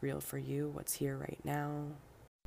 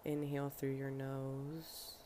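guided breathing meditation; 6 dB quieter than usual. Acted. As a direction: lead each breath softly, leaving room to breathe.

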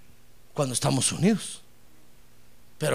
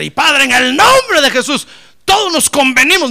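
fiery preaching; 9 semitones higher, 13 dB louder than usual. Acted. Pastor rousing the congregation to agree, loud fiery preaching. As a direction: preach it out with full force.

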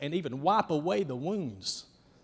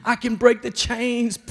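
slow, high, loud calling; a preacher voicing Jesus at the door of the heart; Jesus pleading; 7 semitones higher, 12 dB louder, slower than usual. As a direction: plead loudly and slowly to be let in.

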